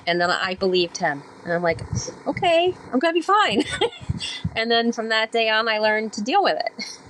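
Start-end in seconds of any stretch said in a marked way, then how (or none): 2.3-3.6 relieved self-talk. Retold or imitated. 3.7-4.5 high laugh. Real, not acted. Laugh after quote.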